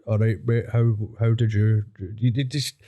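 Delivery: a light put-on Bergie Boy greeting. Mate greeting a pal, a hint of the Bergie Boy voice. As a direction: toss off a half-voiced wee ned hello. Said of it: "when you say things like".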